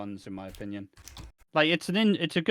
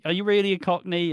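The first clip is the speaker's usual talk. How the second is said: sceptical challenge; 6 semitones higher, 6 dB louder than usual. Retold or imitated. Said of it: "Lofty's like".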